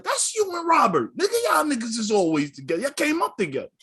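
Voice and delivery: high-pitched imitation of hometown guys; hometown local. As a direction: exclaim it high, like a local calling him out.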